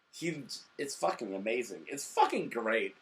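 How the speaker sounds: normal talking voice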